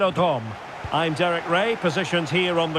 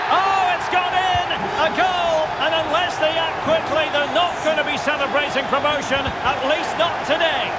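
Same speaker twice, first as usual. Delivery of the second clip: high, soaring commentator's cry, easing slightly; thrilled match commentary; excited goal call; 6 semitones higher than usual. Real, not acted. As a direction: call the goal at full pitch, then ride it down. Commentator calls a goal going in.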